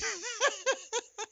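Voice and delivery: a short very high laugh. Real, not acted. Mocking the male politicians, very high laugh. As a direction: cackle briefly, amused.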